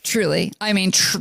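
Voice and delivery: ordinary delivery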